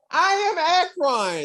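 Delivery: corrected proclamation. Acted. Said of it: "excuse me".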